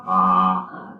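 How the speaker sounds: tongue out, long aah; tongue held down saying aah. Shown as a demonstration. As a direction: open throat aah for the doctor. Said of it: "stick out your tongue and say, "Ah".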